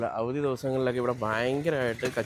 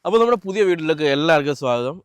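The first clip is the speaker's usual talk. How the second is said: higher and louder, a cheerful host opening; upbeat vlog welcome; 4 semitones higher, 6 dB louder than usual. Real, not acted. Vlogger welcoming viewers to the channel, bright host greeting.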